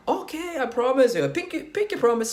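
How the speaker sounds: high put-on girl's voice; girl promising. Girl promises the man not to kill him, high girl voice. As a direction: pitch it up as the agreeable girl. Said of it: "And the girl goes".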